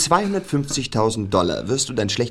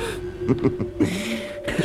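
breathy evil chuckle; 8 dB quieter than usual. Acted. Villain gloats over victim.

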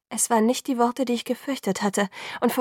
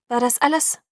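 sharp, cold question, slightly raised; icy; 4 semitones higher, 5 dB louder than usual. A narrator's reading. Alice grilling Jacob about the call, icy. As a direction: interrogate him coldly. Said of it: "fragte Alice eisig".

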